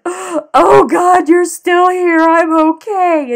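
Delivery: high, loud, slow sobbing relief; crying with relief. Retold or imitated. Teen girl calling her nanny at night, sobbing with relief. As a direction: sob it out like the world just ended and didn't. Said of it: "and I started crying".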